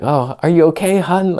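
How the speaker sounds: high, sugary fake worry; mock concern. Teasing fiance who broke a glass, high fake concern. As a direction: overdo the caring tone as a joke. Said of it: "I was just pretending".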